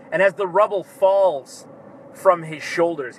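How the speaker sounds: ordinary talk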